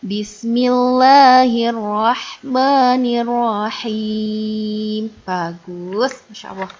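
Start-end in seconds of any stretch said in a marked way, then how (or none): 0.1-5.0 slow, drawn-out chanted recitation; slow Quran recitation. Shown as a demonstration. Teacher recites slowly before the lesson, chanting. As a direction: intone each word slowly like tilawah.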